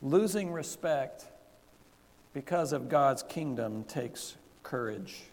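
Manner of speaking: slow, spaced out for note-takers; slow dictation. Shown as a demonstration. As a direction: dictate the point word by word. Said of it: "here's the first point".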